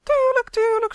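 very high, loud buzzing elf; piercing little insect voice. A narrator's reading. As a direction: buzz it impatiently, high and loud. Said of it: "zoemde Uf".

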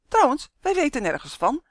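high, loud disclaimer; defensive, pitched well up; hasty denial. A narrator's reading. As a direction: hurry to deny all knowledge. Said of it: "haastte Dummy zich te zeggen".

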